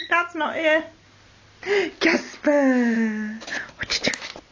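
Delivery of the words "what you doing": soft unvoiced whisper; whispering to a pet. Real, not acted. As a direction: hush it to the bird. Whispering to the parrot.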